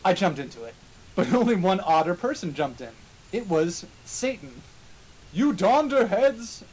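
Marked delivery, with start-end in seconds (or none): 5.3-6.6 slow, drawn-out scream. Acted. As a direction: yell the insult slowly. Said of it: "screamed Dumbledore".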